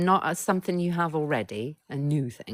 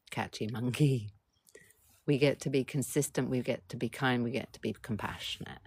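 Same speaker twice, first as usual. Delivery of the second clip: ordinary talk, nothing marked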